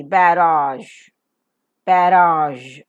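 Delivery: "barrage" as ba-raazh, loud and drawn out; practice model pronunciation. Shown as a demonstration. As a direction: say "barrage" firmly, long second vowel, held zh. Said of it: "Let's practice".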